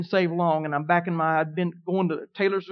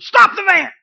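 much higher and louder shout; screamed command; 12 semitones higher, 11 dB louder, slower than usual. Retold or imitated. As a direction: scream it to stop him in time. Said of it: "God had to scream it to me".

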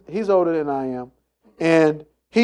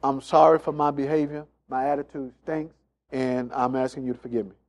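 soft apology to wife; 5 semitones lower, 7 dB quieter than usual. Pretend. Husband softly apologizing to his wife, humble and quiet. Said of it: "you gotta say".

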